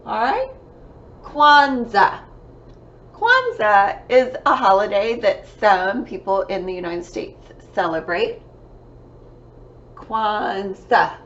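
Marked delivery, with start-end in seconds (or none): 1.2-2.2 "Kwanzaa" loud and high, stretched first syllable. Shown as a demonstration. Teacher introducing a December word, "Kwanzaa" said by syllable. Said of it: "two syllables". 10.0-11.2 "Kwanzaa" drawn out in two separate beats; syllable by syllable. Shown as a demonstration. Teacher counting syllables for pupils, "Kwanzaa" broken in two. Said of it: "two syllables".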